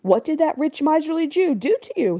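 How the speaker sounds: wife asking the governor what Segel did; wife's question. A narrator's reading. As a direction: echo the insult back as a question. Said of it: "his wife asked".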